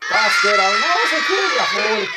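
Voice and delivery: shouted mock German accent; loud fake German accent. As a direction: bellow it in a hammed-up German accent. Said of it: "je vais prendre l'accent de l'allemand".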